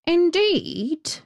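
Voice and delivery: high, emphatic crow voice; talking crow. A narrator's reading. Crow vouches for his story, high crow voice. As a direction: pitch it high as an assuring crow. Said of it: "said the crow".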